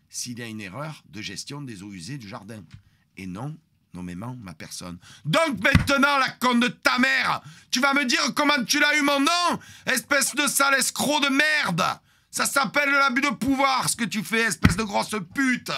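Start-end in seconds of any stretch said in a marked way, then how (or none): 5.2-15.7 screaming insults, much higher and louder; full-throated furious rant; furious yelling. Retold or imitated. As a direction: explode at him with everything. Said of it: "J'ai un petit peu crié".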